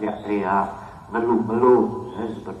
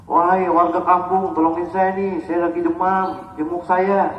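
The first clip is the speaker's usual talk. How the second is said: raised-pitch town-crier plea; mock public appeal; 7 semitones higher than usual. Shown as a demonstration. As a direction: appeal to the whole village, hammed-up. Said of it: "Nggak begitu".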